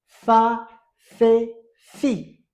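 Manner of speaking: Fa, Fe, Fi called out loud and clear; teacher drilling syllables aloud. Shown as a demonstration. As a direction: chant the syllables loudly for the class. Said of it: "Ihita itanga Fi".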